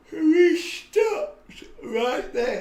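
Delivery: words stretched through a real yawn; sleepy yawning talk. Real, not acted. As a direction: yawn right through the line. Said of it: "as if I'm yawning now".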